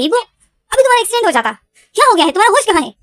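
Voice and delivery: loud, fast, high-pitched scolding. Retold or imitated. Friend scolds Evo after the near accident, loud and fast. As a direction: shake him out of his daze with a sharp telling-off. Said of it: "ईवो को डांट रहा था".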